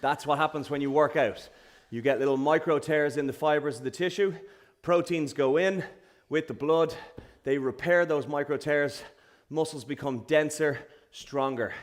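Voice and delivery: soft low stretch guidance; calm cool-down. Real, not acted. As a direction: guide the stretch gently.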